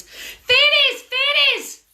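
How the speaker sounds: high loud call; lady screaming to the fairies. Acted. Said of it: "she screamed to the fairies".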